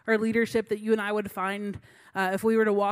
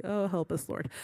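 softer, lower, a despairing aside; muttered prayer; 5 semitones lower, 8 dB quieter than usual. Retold or imitated. She groans to God about the new leader, muttered. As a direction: mutter it to heaven under your breath. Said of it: "I was like".

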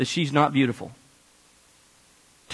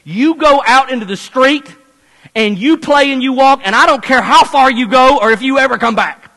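louder, higher exasperated snap; a mother at the end of her rope, raised voice; fed-up mom; 6 semitones higher, 7 dB louder than usual. Retold or imitated. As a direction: mom finally losing her patience. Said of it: "she said".

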